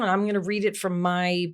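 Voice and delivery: ordinary delivery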